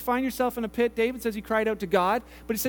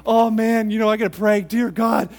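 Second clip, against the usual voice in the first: loud, strained acted plea; mock desperate prayer; 7 dB louder than usual. Fallen hiker praying from a branch, mock desperation. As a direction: play a man begging to be saved.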